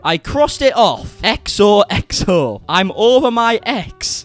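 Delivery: drawn-out theatrical lyric reading; mock-poetic recital. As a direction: proclaim it grandly, word by word.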